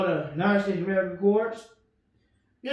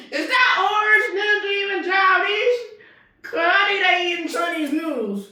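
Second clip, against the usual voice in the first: mocking shout; 9 semitones higher than usual.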